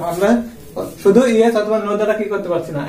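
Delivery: ordinary talk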